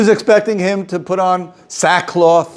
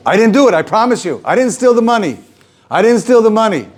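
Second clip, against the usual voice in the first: mock protest; 7 semitones higher than usual. Kuznetzer rebbe insisting to the visitor he didn't steal, mock protest. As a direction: protest your innocence, hammed-up.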